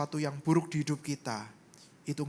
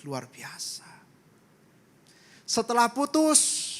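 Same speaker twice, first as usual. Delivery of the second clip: ordinary delivery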